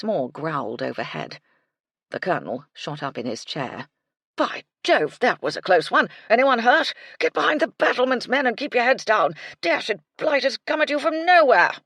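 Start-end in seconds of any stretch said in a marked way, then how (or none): 4.4-11.7 loud, high barking orders; an old soldier back in the trenches; battlefield shout. A narrator's reading. Colonel mistakes thunder for gunfire, shouting orders.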